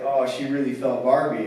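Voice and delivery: ordinary delivery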